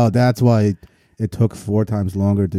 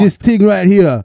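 loud, high hype-man DJ voice; mock hype DJ; 8 semitones higher, 6 dB louder than usual. Mocking the BBC DJ hyping [name]'s freestyle, loud and high. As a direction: ham up an overexcited DJ. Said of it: "DJ hyping up this freestyle".

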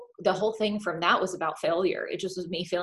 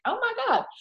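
mock shock. Acting out bedside reaction.

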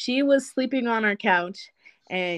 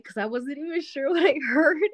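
usual speaking voice

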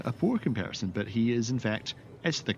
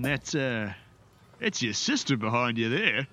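higher, jovial burly dwarf; 5 semitones higher than usual. Acted. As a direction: boom it out like a friendly dwarf. GM voices the dwarf.

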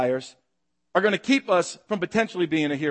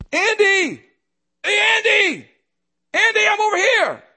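high, loud call across a crowd; 14 semitones higher, 5 dB louder than usual. Pretend. Parent calling a lost kid in a crowd of a thousand, calling out. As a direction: project it far to reach a lost child.

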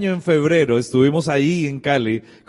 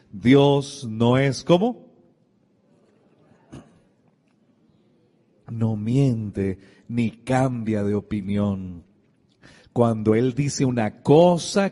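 solemn; 5 semitones lower, much slower than usual. Acted. Solemn slow scripture quote.

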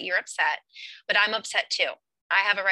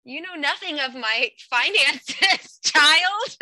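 higher and louder, grandly condescending; mock haughty scolding; 7 semitones higher, 6 dB louder, slower than usual. Mom mocking daughter over money, hammed-up haughty scold. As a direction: talk down to her like a pompous aristocrat. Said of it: "I'll get right back in her face".

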